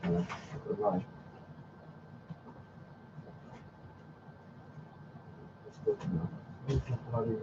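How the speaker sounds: barely voiced grumble to himself; whispered irritated grumbling; muttered under breath. Real, not acted. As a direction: mutter it off-mic, annoyed. Muttering to himself while searching.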